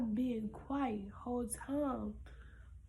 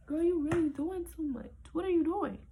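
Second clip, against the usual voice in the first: self-scolding; 5 semitones higher, 6 dB louder than usual. Retold or imitated. Scolding herself for noisy gate.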